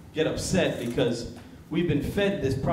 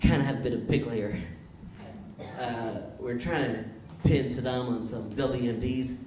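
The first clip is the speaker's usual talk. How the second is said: impression of a folksy American president; George Bush; 3 semitones lower than usual. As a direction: greet him in a Bush impression. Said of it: "George Bush calls him up".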